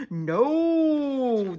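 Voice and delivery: long, high, loud refusal; crowd yell. Acted. Crowd answering Pip, yelled. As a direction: stretch it out like a crowd. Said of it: "the crowd yelled".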